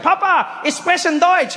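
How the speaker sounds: very high, excited German greeting; proud announcement. Acted. Son showing off his German to dad, excited.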